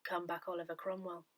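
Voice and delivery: a soft, low-key muttered aside; retold mutter. Widow imitating her late husband's muttered quip at the chapels. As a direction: grumble it under the breath, half to yourself.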